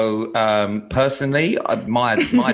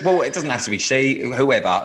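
ordinary delivery

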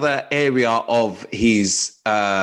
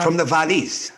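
higher-pitched Welsh lilt; Welsh accent; 6 semitones higher than usual. Shown as a demonstration. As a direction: put on a sing-song Welsh voice. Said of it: "I'm just trying to do Welsh".

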